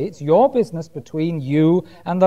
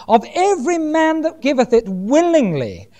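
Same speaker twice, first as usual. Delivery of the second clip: raised pitch, leaning firmly on "willingly"; stress on WILLINGLY; 6 semitones higher than usual. Shown as a demonstration. Preacher reading God's command, "willingly" emphasised. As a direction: make "willingly" the point of the verse. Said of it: "Do you see the emphasis on willingly".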